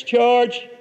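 half-hearted retold yell of charge; retold shout. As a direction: call the charge like a soldier going over the top. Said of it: "he shouts".

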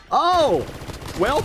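high, loud startled oh; surprised oh. Real, not acted. Gamer caught off guard, high and loud. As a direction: jolt up on the oh.

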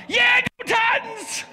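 high, slow drawn-out fan cheer; cheering yell at the game; stadium cheer. Retold or imitated. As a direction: holler for your team. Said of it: "I'm like".

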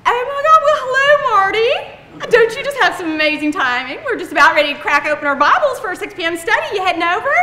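high, loud, delighted phone greeting; hammed-up church-lady enthusiasm; mock gushing welcome. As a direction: greet the caller like a dear friend. Gushing at telemarketer as old friend.

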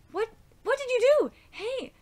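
high, startled questioning; alarmed demand. Acted. Hero reacting to a sudden shot, alarmed.

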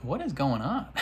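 high, incredulous at the sirens; annoyed at the sirens outside; high exasperated disbelief; real exasperation. Real, not acted. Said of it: "fifth police car".

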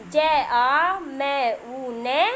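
slow letter-by-letter dictation with pauses; spelling out slowly. Shown as a demonstration. Teacher spelling a word for young children, slow dictation. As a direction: draw out each letter sound for learners.

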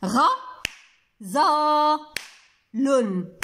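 غزال broken into three separate syllables; slow syllable-by-syllable sounding out; syllables with claps. Shown as a demonstration. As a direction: pause fully after each syllable, as if clapping. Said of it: "غادي نديرها بالمقاطع وبالتصفيق".

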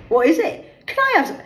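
put-on high, loud teen begging; pleading daughter. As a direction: wheedle for a bite.

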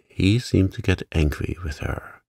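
usual speaking voice

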